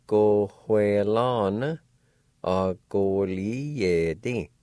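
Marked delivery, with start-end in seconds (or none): 0.1-1.8 slow demo. Shown as a demonstration. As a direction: go hue lan drawn out very slowly. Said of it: "And together".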